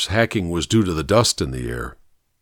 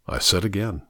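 usual speaking voice

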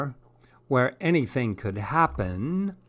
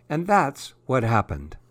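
usual speaking voice

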